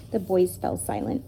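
ordinary delivery

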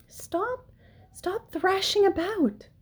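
high, airy scolding; airy, high-pitched plea for calm; hushing command. A narrator's reading. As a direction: hush a frantic child in a high voice. Spider tells frantic pig to settle.

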